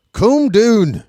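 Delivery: high, loud mock-Irish cry; Irish accent. Shown as a demonstration. Commentator quoting an Irish lady from a movie, Irish accent. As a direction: shout it like an Irish lady, "coom doon". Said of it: "That's my best Irish accent".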